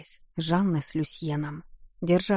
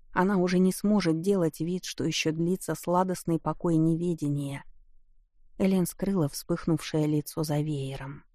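ordinary delivery, nothing marked

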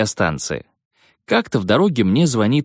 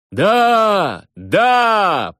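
loud high yes from the crowd; crowd yelling; 10 semitones higher, 3 dB louder than usual. Retold or imitated. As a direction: shout it back like a stadium. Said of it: "понеслось мне в ответ".

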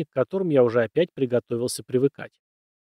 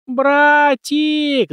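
high, loud terrified shriek; 13 semitones higher, 8 dB louder than usual. A narrator's reading. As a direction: scream for her brother. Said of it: "заверещала".

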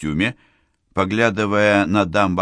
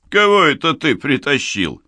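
put-on drunken shout; 7 semitones higher, 4 dB louder than usual. Drunk captain keeps shouting.

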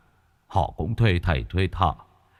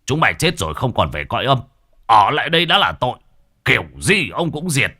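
loud, fast, blustering threat; a brash exorcist bellowing; booming; 5 semitones higher, 3 dB louder, faster than usual. Acted. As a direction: boom it out at the ghosts. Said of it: "Nhà Nhị Toét oang oang".

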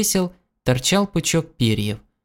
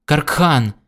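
cry of fear; 2 semitones higher than usual. A narrator's reading. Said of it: "вдруг закричал в страхе".

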